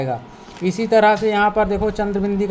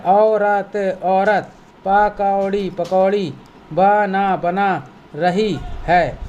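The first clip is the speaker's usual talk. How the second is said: slow word-by-word reading; slower than usual. Shown as a demonstration. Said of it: "teacher syllable reading".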